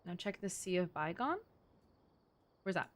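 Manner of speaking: ordinary talk, nothing marked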